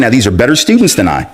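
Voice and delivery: normal talking voice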